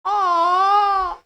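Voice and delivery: high, loud, cried-out आ; loud demo आ. Shown as a demonstration. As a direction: call out a long आ. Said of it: "कैसे पढ़ना है". (ऊँची पुकार वाला आ)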